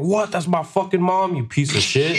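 high-pitched incredulous retort breaking into a laugh; indignant outburst. Retold or imitated. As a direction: protest in disbelief, half laughing. Retelling outburst at mentor over mom.

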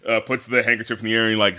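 normal talking voice

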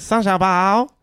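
put-on light Chinese accent. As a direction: repeat the name in a Chinese accent. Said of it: "I said".

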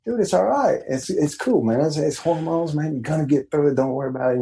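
high, easy reassurance to a crying son; reassuring son. Retold or imitated. Father comforting his crying preteen son, high and reassuring. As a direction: soothe him, it's just hormones. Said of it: "I'm like".

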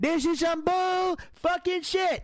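high, drawn-out exasperated swearing; pissed off. Real, not acted. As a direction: drag out the curse in outrage. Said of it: "I'm too pissed off".

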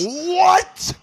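very high-pitched disbelieving outburst; incredulous. Real, not acted. Reacting to friend's confession, incredulous. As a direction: let disbelief send the voice way up.

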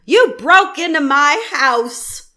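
loud slow outraged yell. Retold or imitated. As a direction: shout it slowly at the cops. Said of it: "Matthew yells at the cops".

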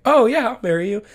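high, loud happy yes; elated acceptance. Retold or imitated. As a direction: accept joyfully. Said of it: "She's like".

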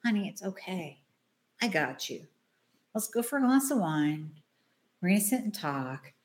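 calm her down like a caring friend; soothing friend. Retold or imitated. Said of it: "she comes in and she goes".

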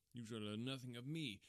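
usual speaking voice

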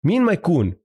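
ordinary delivery

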